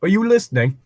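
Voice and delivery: a guard snapping someone back to attention; guard's question. Acted. Guard asking the distracted slave, a touch stern. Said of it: "by the guard's question".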